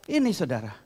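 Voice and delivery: ordinary talk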